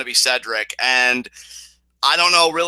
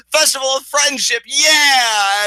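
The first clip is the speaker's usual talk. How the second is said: high-energy showman cheer, pitched way up; 10 semitones higher, 4 dB louder, slower than usual. Retold or imitated. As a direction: imitate a pumped-up wrestler hyping the crowd. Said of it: "[name]'s high energy is like".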